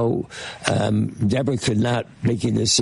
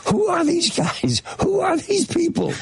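much higher, incredulous mock Jack voice; bewildered Jack. Producer imitating Jack, high-pitched and baffled by the patients. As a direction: squeak it out, baffled and alarmed.

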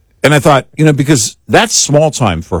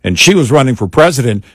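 ordinary delivery

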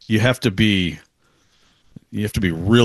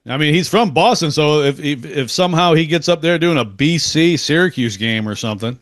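ordinary delivery

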